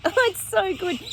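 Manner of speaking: slow giggly delight; laughing with glee. Real, not acted. Gleeful about Trump.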